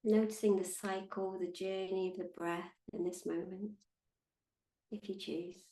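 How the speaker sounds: calm, unhurried meditation guide with long pauses; measured, soothing meditation voice; guided meditation. Acted. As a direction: guide them gently into stillness, leaving space between phrases.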